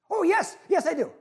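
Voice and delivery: high-pitched hurried assurance; acted desperate eagerness; eager tourist.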